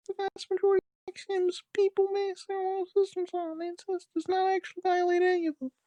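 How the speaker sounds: put-on high, loud girly voice; frantic girl voice. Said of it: "she continued frantically".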